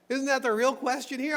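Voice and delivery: normal talking voice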